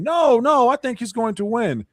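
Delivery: high-pitched mocking protest, loud at first; mock berating. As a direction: imitate people insistently scolding you. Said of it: "all of you berated me saying".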